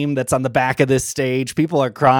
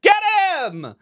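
distant shout. Acted. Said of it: "a distant voice that goes".

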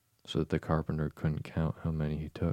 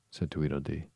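usual speaking voice

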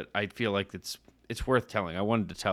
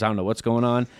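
ordinary talk

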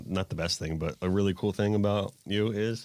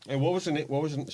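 eating an apple; 7 semitones higher than usual. Real, not acted. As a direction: mouth full of apple. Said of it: "sorry, I'm eating an apple".

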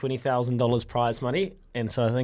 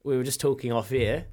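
normal talking voice

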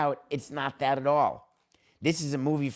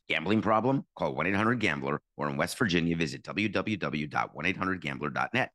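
brisk sponsor read with fast legal fine print; 5 semitones lower than usual. Acted. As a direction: sell it like a host-read ad. Host reads sponsor ad.

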